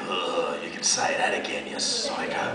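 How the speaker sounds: put-on teen muttering to himself about the stranger, scornful; sarcastic mutter. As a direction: mutter it under your breath, deadpan. Sarcastic inner thought.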